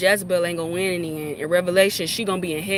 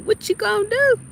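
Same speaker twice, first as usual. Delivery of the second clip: mocking high taunt; 11 semitones higher than usual. Real, not acted.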